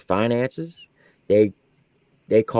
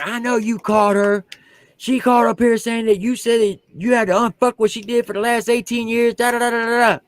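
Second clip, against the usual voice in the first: high, lightly voiced accusing colonel; mock colonel; 10 semitones higher than usual. Drill sergeant retelling his colonel's accusation in a high voice.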